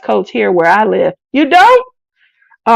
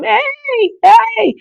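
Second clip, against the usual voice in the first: flirty call; 12 semitones higher than usual. Pretend. Calling out to a cutie.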